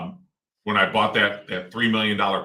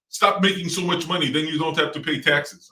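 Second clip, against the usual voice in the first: put-on dad's old-country accent; 7 semitones higher than usual. Son imitates his immigrant dad's advice in the dad's old-country accent. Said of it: "in his accent".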